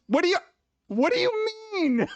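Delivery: can't believe the nerve; incredulous what do you mean. Retold or imitated. Disbelief at being blamed.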